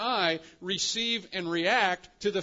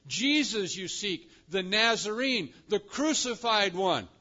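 higher, deliberate stress on each title; emphatic; 5 semitones higher, 3 dB louder than usual. Acted. Angel identifies Jesus to the women, emphatic. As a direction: ring out "Jesus", "Nazarene", "crucified". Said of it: "he says with emphasis".

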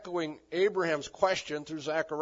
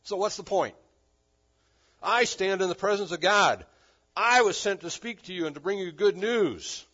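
loud, raised, emphatic comeback; forceful retort; 5 semitones higher, 9 dB louder than usual. Acted. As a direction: pull rank as the angel, loudly. Acts Gabriel's forceful retort to Zechariah.

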